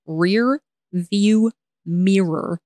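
REAR, VIEW, MIRROR drawn out one word at a time; slow, separated words. Shown as a demonstration. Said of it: "unless I say it like that".

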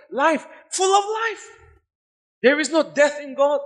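exultant pulpit shout; ecstatic preaching cry. Real, not acted. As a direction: lift the roof on full of life. Preacher climaxes on life.

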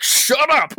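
high, hammed-up shut-up; mock snap. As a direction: imitate a breathless friend snapping. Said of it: "And you're like".

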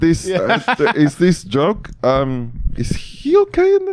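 very high, confused put-on voice; baffled Bond. Bond puzzled by the villain's joke, very high baffled voice. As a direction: squeak out the confusion. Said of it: "He's like".

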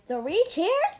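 high, loud cheer; rousing cheer. A narrator's reading. Ninja cheer their master, loud high cheer. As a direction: lead a hurrah, stretching the end.

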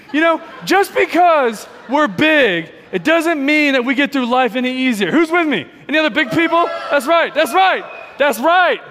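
loud, high, rallying the crowd; pumping up the room; excited crowd hype. Real, not acted. Hyping the big people.

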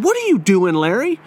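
retold stern demand, no real shouting; mock stern challenge. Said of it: "get my mean look on my face".

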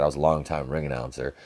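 usual speaking voice

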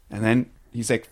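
ordinary delivery

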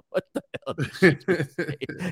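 slow, chuckling disbelief through a laugh. Retold or imitated. Husband laughing at his wife's sarcastic remark, chuckling disbelief. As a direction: laugh it out, amazed at her comeback. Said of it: "inside he's probably laughing".

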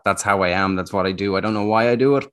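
usual speaking voice

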